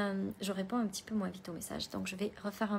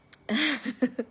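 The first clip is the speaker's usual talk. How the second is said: tired laugh; 3 semitones higher, 8 dB louder than usual. Real, not acted.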